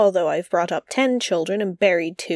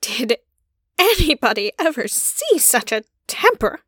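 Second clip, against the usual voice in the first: appalled; 3 semitones higher than usual. Acted. Lynde appalled at outburst.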